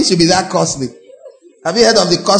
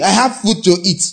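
usual speaking voice

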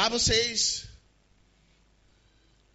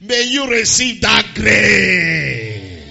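slow, loud preacher shout; preaching; 10 dB louder, much slower than usual. Acted. Preacher shouting slowly to the church. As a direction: drive it home to the congregation.

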